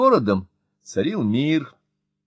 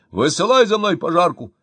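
high, loud command; shouting order; 7 semitones higher, 6 dB louder than usual. A narrator's reading. Mayor ordering the fire chief, loud high command. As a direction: bark the order down the line. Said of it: "крикнул Мощин".

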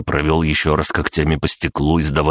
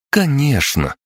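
high, doting exclamation; cooing; 10 semitones higher, slower than usual. A narrator's reading. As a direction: melt over an adorable creature in a high voice. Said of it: "дружно умилились поварихи". (умилённо)

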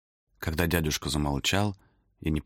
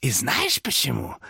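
high, slow scheming demon; loud whisper. A narrator's reading. Demon whispers to Thomas to punish the peddler. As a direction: hiss the plot into his ear. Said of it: "Демон перешёл на громкий шёпот".

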